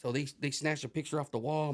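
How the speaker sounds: ordinary delivery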